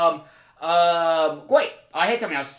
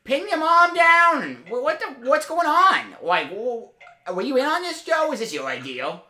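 high, loud, indignant call-out; raised voice, pitched way up in comic outrage; mock outraged shouting; 9 semitones higher, 4 dB louder than usual. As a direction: demand answers from an absent guest, worked up. Mock tirade at absent guest Joe.

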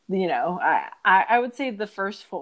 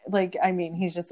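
ordinary delivery

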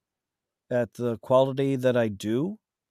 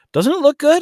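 excited; 9 dB louder than usual. Real, not acted. Guest proudly shows his book cover.

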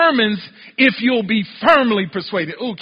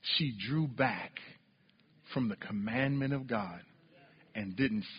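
much softer and lower sermon voice; quiet preaching; 10 semitones lower, 13 dB quieter than usual. Acted. To the congregation, hushed low preaching about Eve. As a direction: bring it down to a hush.

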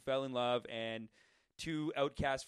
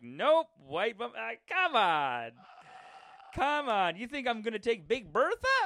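drawn-out, higher, exaggerated disbelief; incredulous mock rejection; 6 semitones higher, 3 dB louder, slower than usual. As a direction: mimic a friend scoffing in disbelief. Mimics buddy's incredulous rejection.

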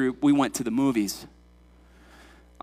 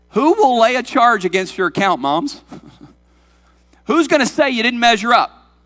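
raised pitch, pressing rhetorical questions; impassioned preaching; 7 semitones higher, 5 dB louder than usual. Real, not acted. Preacher challenging any accuser of the congregation, impassioned. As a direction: preach it out with conviction, higher and firmer.

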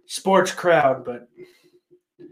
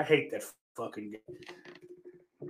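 ordinary talk, nothing marked

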